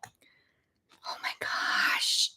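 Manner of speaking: breathless hushed whisper of delight; awed whisper. Real, not acted. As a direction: whisper it, overcome by how cute it is. Gushing over a cute card.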